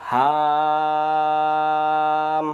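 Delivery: হাম with the a held very long; three alif stretch. Shown as a demonstration. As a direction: draw the vowel out like a recitation. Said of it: "তিন আলিফ হয়ে গেল".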